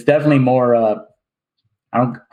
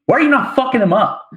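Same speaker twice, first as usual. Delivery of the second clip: incredulous; 9 semitones higher than usual. Retold or imitated. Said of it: "And I was like".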